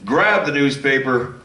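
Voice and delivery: ordinary talk, nothing marked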